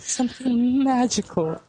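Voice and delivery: high, airy mock-magical voice; hammed-up Disney wonder. Echoing a friend on the magical ending, mock wonder.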